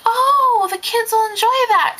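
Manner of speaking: appropriately polite. Retold or imitated. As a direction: sound gracious, not greedy. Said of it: "I wanted to be like".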